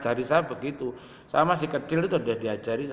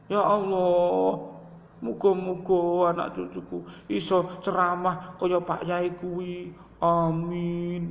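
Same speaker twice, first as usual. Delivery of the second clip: higher, slow, weeping plea; tearful prayer; 6 semitones higher, slower than usual. Retold or imitated. Preacher imitating his mother crying in prayer, higher and tearful. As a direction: pray through tears for your child. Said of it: "ibuku nangis meneh".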